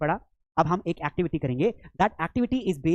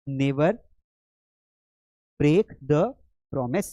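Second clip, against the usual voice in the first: "Never ... break the ... promise" with long pauses between pieces; word by word; 2 semitones lower, much slower than usual. Shown as a demonstration. Teacher models answer piece by piece. ("Never break the promise" रुक-रुक कर, टुकड़ों में बोलें)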